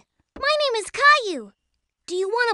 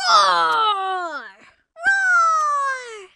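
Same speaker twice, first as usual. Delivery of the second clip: dragon roar; 4 semitones higher, 4 dB louder than usual. Pretend. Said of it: "And then the dragon roared!".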